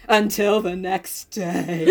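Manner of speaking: slow, ominous narrator announcement; mock-dramatic scene change; dramatic cliffhanger. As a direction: draw it out for suspense.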